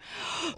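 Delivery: sudden unvoiced gasp of realization; startled gasp at the hosts' trick; sharp gasp. Real, not acted. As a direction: gasp as it clicks.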